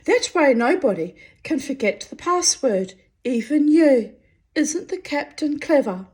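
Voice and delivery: high, loud, pleased sentry voice; proud sentry. A narrator's reading. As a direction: brag about the captain.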